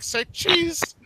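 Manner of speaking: put-on high, clipped Japanese accent. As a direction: chirp it in a little Japanese accent. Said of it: "like, in a little Japanese accent".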